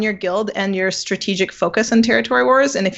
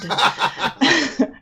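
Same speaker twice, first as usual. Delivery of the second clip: laughing; 5 semitones higher than usual. Real, not acted. Laughs at own pirate bit.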